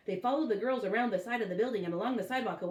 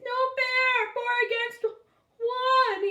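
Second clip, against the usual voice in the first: much higher, louder crying shout; tearful shout; 8 dB louder, slower than usual. A narrator's reading. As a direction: bawl it as he runs off. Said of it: "he shouted".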